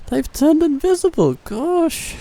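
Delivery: high amused surprise; surprised laughing. Real, not acted. Surprised at invisible units.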